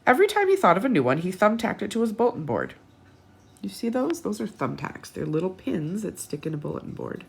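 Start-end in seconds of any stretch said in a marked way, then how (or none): none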